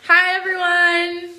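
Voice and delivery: high, slow, drawn-out cheery hello; vlog greeting. Acted. Greeting vlog viewers at the start, a high drawn-out cheery hi. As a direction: stretch the hi out brightly for the camera.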